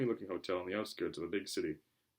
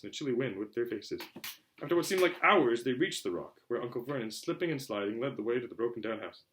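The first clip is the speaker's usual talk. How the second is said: ordinary delivery, nothing marked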